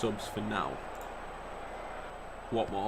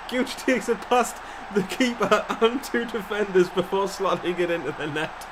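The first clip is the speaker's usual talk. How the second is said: higher, giggly commentary; laughing through the call; 6 semitones higher than usual. Real, not acted. Describing the goal to viewers, laughing.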